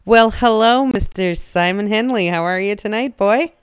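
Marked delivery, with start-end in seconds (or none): none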